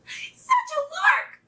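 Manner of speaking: very high, drawn-out glee; gleeful little pup, squeaky high; excited pup's cry. A narrator's reading. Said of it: "cried wee Peter".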